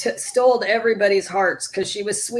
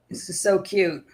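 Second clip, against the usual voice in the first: ordinary talk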